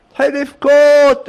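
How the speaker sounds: hammed-up burst of weeping; mock wail. A narrator's reading. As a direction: stretch the word into a wail. Narrator acts out bursting into tears.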